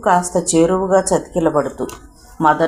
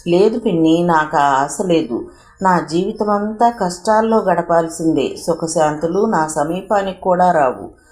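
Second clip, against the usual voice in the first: usual speaking voice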